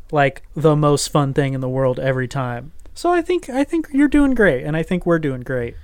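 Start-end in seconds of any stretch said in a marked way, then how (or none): 2.9-4.6 encouraging. Real, not acted. Cheering cohost up, Captain Positive.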